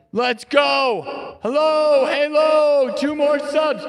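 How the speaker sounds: high excited shouting; hype countdown. Real, not acted. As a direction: yell it like the goal's about to hit. Sub goal nearly reached, shouting.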